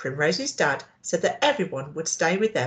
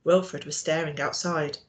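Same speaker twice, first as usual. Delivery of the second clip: usual speaking voice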